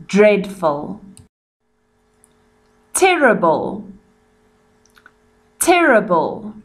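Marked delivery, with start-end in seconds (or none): none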